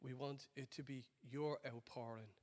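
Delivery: prayer. Acted. Pastor praying over the congregation, soft and low.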